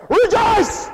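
high, loud command; shouted command. Shown as a demonstration. Pastor showing how to command people to rejoice, shouted high. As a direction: bark it out as an order. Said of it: "How do you get people to do that".

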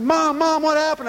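put-on startled child calling; alarmed call. Calling out after crash.

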